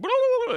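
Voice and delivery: high, loud rat babble; imitated rat noise. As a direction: mimic a rat's noise way up high. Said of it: "it was one going just like".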